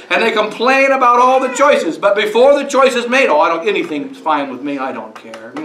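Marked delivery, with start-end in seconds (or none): 3.3-5.5 lazy, indifferent mumble, fading much softer. Retold or imitated. Student ordering pizza, lazy and indifferent mumble. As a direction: shrug it off, softer and lower as it trails away. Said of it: "we're indifferent".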